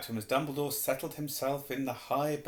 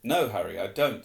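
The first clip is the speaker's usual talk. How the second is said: firm, louder headmaster voice; Dumbledore, corrected accent; 2 semitones lower, 6 dB louder than usual. Acted. Dumbledore reassures Harry he is not suspected, corrected accent. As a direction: restart in the headmaster's proper accent. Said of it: "wrong accent, sorry".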